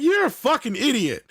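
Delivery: put-on high-pitched mouthy kid retort; going off. Smart-mouthed kid to a cop, going off. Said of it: "he's just going off on the cop".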